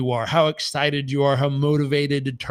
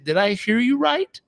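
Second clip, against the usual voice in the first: incredulous; 8 semitones higher than usual. Retold or imitated. Coach retelling disbelieving reply.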